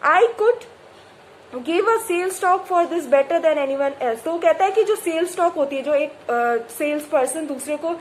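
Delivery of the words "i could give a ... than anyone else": slow, deliberate reading aloud; measured textbook reading; story reading. A narrator's reading. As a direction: read the novel line carefully. Reads story text slowly.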